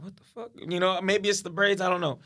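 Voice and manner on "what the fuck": puzzled aside. Retold or imitated. Man to himself as strangers look away, hushed puzzlement. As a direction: say it under your breath.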